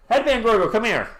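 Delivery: high, loud cheerful call; excited greeting. Real, not acted. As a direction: call out to a friend happily.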